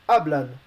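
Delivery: hablan said in Spanish, stress on HA; Spanish pronunciation. Shown as a demonstration. Teacher showing students how to say hablan, careful Spanish. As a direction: say hablan as a native, HAB-lan. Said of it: "you're supposed to pronounce".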